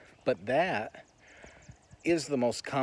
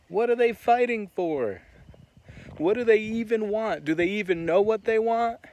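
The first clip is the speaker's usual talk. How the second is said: high, loud mocking cop; 9 semitones higher, 7 dB louder than usual. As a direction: sneer it like a dismissive cop. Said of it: "heard the Boston police say that".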